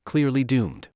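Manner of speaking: usual speaking voice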